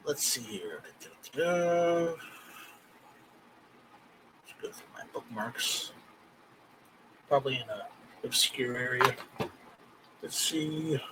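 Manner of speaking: very quiet, low self-talk while searching; muttering to oneself. Real, not acted. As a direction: think aloud under your breath, barely voiced. Muttering to himself while searching bookmarks.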